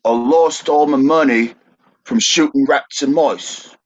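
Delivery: broad Cockney vowels, mice said like "moice"; Cockney accent. Shown as a demonstration. Host uses the slang in a sentence for confused contestant, broad Cockney. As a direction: give the example sentence in Cockney. How would you use it in a sentence.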